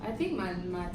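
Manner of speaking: words slurred by a numb mouth. Real, not acted. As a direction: talk through a burning, numb mouth. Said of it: "my mouth is going numb".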